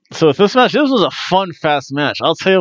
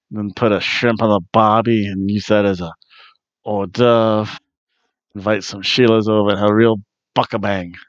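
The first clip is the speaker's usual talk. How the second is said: put-on lower, jokey Australian accent; 6 semitones lower than usual. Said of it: "I have to use my Australian accent".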